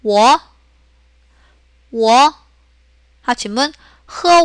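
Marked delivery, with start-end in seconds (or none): 0.0-2.3 워 starting low and gliding upward; second tone. Shown as a demonstration. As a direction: lift 워 at the end. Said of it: "워에서 이승".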